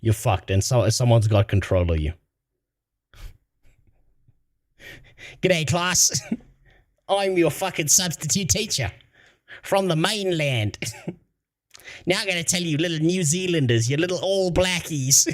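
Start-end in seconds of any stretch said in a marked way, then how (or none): 5.4-15.4 lightly done Australian substitute teacher; a mild Aussie twang, teacherly and mocking; Australian accent. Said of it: "The closest I can get is an Australian one".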